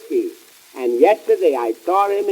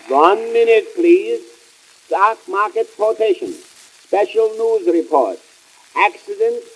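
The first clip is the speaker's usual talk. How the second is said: mock announcer call; 2 semitones higher, 3 dB louder than usual. Comic radio host calling a break to listeners. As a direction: sing out the break like a station host.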